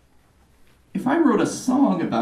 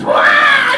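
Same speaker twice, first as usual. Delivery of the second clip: put-on loud baby cry; baby wail; 8 dB louder than usual. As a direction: wail like a newborn. Baby wailing in story.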